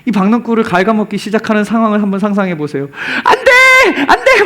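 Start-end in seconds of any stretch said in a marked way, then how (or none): 3.2-4.4 high, loud wail of protest as Jonah; hammed-up shriek of dismay; imagined panicked cry. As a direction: cry out in mock despair. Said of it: "막 이랬을 것 같아요".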